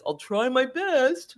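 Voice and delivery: slow, unsure pilot, lightly voiced; hedging pilot. Pilot answering the passenger, slow and unsure. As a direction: let the promise sag, a hint of a doubtful pilot. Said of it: "And the pilot says".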